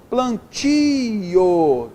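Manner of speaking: "Plantio" slow, "ti" stretched long; stressed oral syllable. Shown as a demonstration. Teacher showing an oral tonic, "Plantio" with drawn-out "ti". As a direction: dwell on the "ti" of "Plantio". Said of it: "tônica oral".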